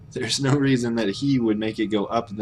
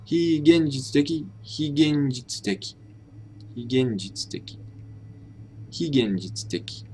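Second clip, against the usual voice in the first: 非現実的 tried with a rising then falling pitch; trying out pitch accent; 3 semitones higher than usual. Shown as a demonstration. Learner sounding out 非現実的 while reading the dictionary, testing tone. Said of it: "square brackets mean rising intonation".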